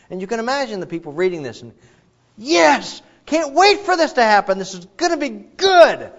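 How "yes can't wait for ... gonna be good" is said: much higher, louder, thrilled cheer; excited reaction. Retold or imitated. Imagined readers cheer the promise, thrilled and loud.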